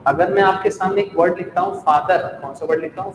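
usual speaking voice

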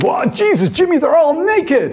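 high, slow, shocked exclamation; shocked Americans. Retold or imitated. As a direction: gasp it like a scandalised visitor. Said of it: "the Americans".

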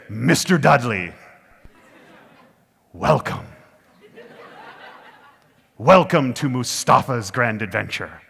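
slow, showman's welcome with big pauses; theatrical, drawn-out greeting; grand self-introduction. Retold or imitated. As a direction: announce yourself like a grand adventure host. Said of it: "everyone should introduce themselves like that".

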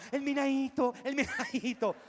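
high, loud excited woman's voice; the woman squealing. Retold or imitated. The woman answers her man, high and loud squealing. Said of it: "E la donna".

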